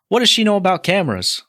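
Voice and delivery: lightly voiced [name] rant; quoting [name]. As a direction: toss off a [name] quote as a joke. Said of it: "that's a [name] quote".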